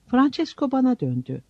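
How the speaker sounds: plain reading voice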